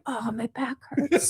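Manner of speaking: small child mocking.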